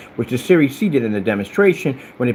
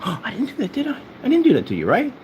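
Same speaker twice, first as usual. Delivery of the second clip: mock innocence; 11 semitones higher than usual. Feigns innocence after gasp.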